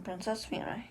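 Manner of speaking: usual speaking voice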